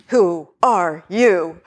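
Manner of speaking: slow, drawn-out caterpillar voice; caterpillar's question. Retold or imitated. Alice's caterpillar interrogating her, slow and lofty. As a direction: draw it out like the Wonderland caterpillar. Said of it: "Zen master caterpillar".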